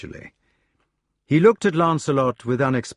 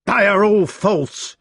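high, louder, slow angry cry; 8 semitones higher, 5 dB louder, slower than usual. A narrator's reading. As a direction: drag it out in fury. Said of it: "cried Sir Meleagrance".

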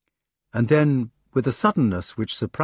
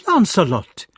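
loud, higher-pitched outrage; shocked rebuke; 9 semitones higher, 6 dB louder than usual. A narrator's reading. Guinevere rebuking Lancelot for his jealous taunt, outraged. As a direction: cry his name in reproach.

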